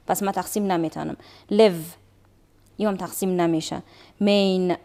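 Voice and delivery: ordinary talk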